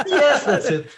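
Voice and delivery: high laughing yes. Real, not acted. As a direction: laugh through the words. Laughing agreement.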